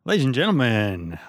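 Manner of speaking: grand, high showman reveal; announcer voice. Acted. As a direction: bring on the pick like a ringmaster. Hosts' announcer intro.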